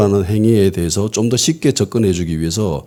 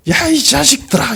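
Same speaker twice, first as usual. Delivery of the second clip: high-pitched indignant rebuke; retold scolding. Jesus rebukes his unbelieving disciples, in an acted high-pitched scolding. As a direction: cry out as if telling them off. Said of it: "꾸짖음입니다".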